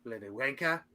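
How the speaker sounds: put-on tentative try at a British accent. Trying to find a British voice, tentative British accent. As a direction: feel around for a British voice. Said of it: "I'm trying to find a British voice".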